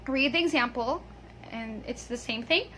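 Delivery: ordinary delivery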